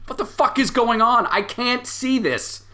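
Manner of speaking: high, frantic panicked yell; a clown losing it, shouting in panic. Acted. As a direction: freak out, shouting in terror. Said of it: "starts shouting".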